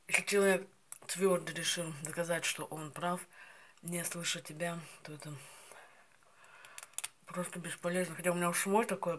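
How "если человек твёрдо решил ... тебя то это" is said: speaking while chewing; words through biting teeth; mouth full of berries. Real, not acted. Seen 0: Holds currant sprig while talking, then bites berries off at 4.4s.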